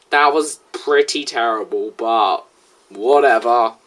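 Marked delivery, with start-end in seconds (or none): none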